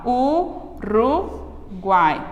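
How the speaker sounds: Uruguai drawn out slowly as one syllable group; slow demo. Shown as a demonstration. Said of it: "uma sílaba só". (Uruguai bem devagar)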